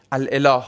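الاله said as al-elah, with the hamza; original full pronunciation. Shown as a demonstration. Teacher showing the hard older form, الاله said as al-elah. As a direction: keep both vowels apart in الاله, al-elah. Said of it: "این الاله تلفظش چی بوده؟".

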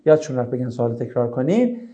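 normal talking voice

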